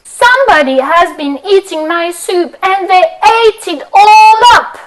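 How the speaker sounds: put-on Baby Bear. Baby Bear upset his soup is gone, high and loud. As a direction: squeak it out as baby bear, rising to a cry. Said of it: "he says".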